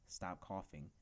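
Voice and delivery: ordinary delivery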